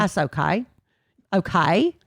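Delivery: Australian vowel on "okay"; Australian accent. Shown as a demonstration. Co-host demonstrating the lady's accent again on request, Australian accent. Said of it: "That was Australian".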